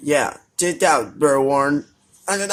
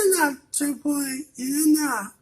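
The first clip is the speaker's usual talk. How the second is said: squeaky high-pitched telling-off; high scolding character; 12 semitones higher than usual. Pretend. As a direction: scold like a tiny cartoon pet.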